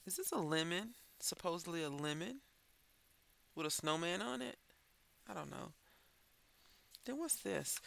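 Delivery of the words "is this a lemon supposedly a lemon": normal talking voice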